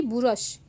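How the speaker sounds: बुरश slowly, with an extra u between b and r; wrong spelling sound. Shown as a demonstration. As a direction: drag out बुरश with an inserted vowel. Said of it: "ये बुरुष नहीं होता है".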